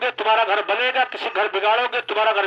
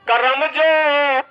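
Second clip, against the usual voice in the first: slow solemn maxim; much slower than usual. Acted. Moral maxim over music.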